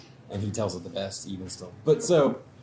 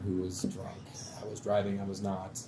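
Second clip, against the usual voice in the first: ordinary talk, nothing marked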